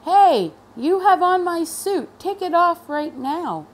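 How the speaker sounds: high, loud outraged demand; a boss claiming his suit back; indignant principal. A narrator's reading. Principal demands his suit from the teacher, indignant. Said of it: "The principal looked at the teacher and said".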